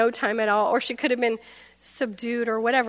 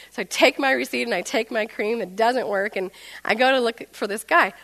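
normal talking voice

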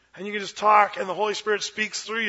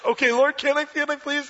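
eager pleading; 7 semitones higher, 3 dB louder than usual. Acted. Balaam begs the Lord again.

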